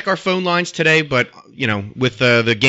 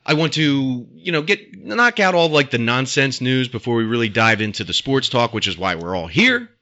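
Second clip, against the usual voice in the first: ordinary delivery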